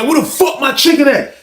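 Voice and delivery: high-pitched, indignant question; acted angry demand. Inmate confronting returning guard over chicken, acted angry demand. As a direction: demand it, imitating an irate inmate.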